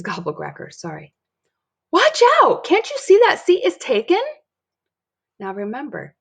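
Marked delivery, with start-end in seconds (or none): 1.9-4.2 put-on a child's high shout, much higher and louder; alarmed shout. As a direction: cry out in alarm to stop him sitting. Dory shouts at dad sitting.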